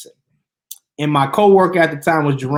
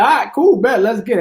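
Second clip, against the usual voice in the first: excited; 7 semitones higher, 4 dB louder than usual. Retold or imitated. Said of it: "I was like".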